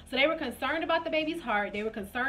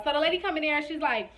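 usual speaking voice

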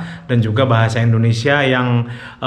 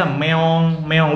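cat meow imitation; 2 semitones higher than usual. Shown as a demonstration. Host shows the Indonesian cat sound, lightly imitated. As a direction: mimic a cat saying meong. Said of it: "Here in Indonesia".

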